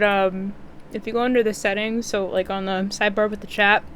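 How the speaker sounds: usual speaking voice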